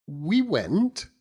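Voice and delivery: high, earnest matronly lady voice; high-pitched loyal wife holding forth; Mrs Micawber. Acted. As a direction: lift into the proud, insistent wife.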